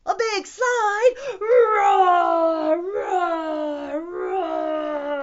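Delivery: high, very loud dinosaur shout; battle roar. Acted. Dinosaur takes on a slide.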